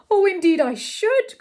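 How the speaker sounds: high, loud, eager exclamation; excited cry. A narrator's reading. Pupil offered a gallop beside the royal barouche, excited cry. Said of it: "cried Mister Saltina".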